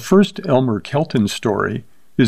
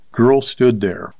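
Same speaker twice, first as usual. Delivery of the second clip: ordinary delivery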